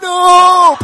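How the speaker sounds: huge high mock scream of horror; hammed-up long, wailing "no"; anguished yell. Retelling a man dropping his new phone, hammed-up high yell.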